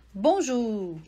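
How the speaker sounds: Bonjour with French U, bõʒu. Shown as a demonstration. Teacher repeating for student: French bonjour. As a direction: repeat Bonjour slowly and clearly. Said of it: "correspondente ao U".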